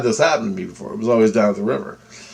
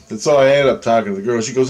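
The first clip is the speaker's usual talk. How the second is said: ordinary delivery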